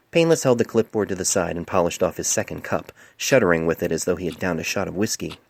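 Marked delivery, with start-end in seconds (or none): none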